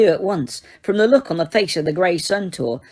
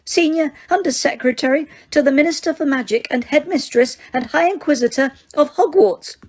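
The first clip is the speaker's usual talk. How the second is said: much higher, tight and frightened Umbridge voice; high-pitched terrified; 8 semitones higher than usual. A narrator's reading. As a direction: squeak it out in panic, clinging to her titles. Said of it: "in a high-pitched, terrified voice".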